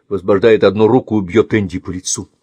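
ordinary delivery

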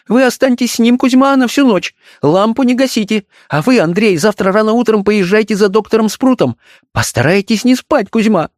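high-pitched, anxious mistress; agitated orders. A narrator's reading. Wife gives servants orders for the night, agitated. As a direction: give instructions in a high, agitated woman's voice. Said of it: "взволнованным голосом отдавала приказания".

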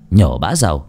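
plain reading voice

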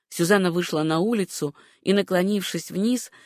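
usual speaking voice

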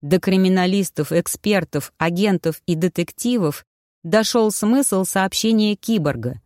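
plain reading voice

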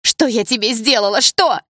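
high, loud cry of hurt; anguished outcry. A narrator's reading. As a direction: cry out the accusation in pain, climbing on the last word. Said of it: "задыхаясь от боли".

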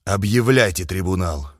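low and grim, final word; a low, heavy parting threat; low grim commander voice. Acted. Suvorov's parting words.

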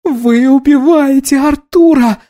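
high, drawn-out wail. A narrator's reading. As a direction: wail in desperate accusation. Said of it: "завыла Анна".

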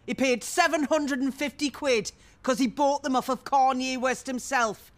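high, loud burst of revelation; scandalised gossip's big disclosure; emphatic correction. Acted. Gleefully correcting with the bigger price.

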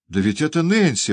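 high-pitched startled recognition; amazed exclamation. A narrator's reading. Oliver suddenly seeing the woman's face, exclaiming in amazement. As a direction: blurt it out in astonishment. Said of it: "воскликнул Оливер".

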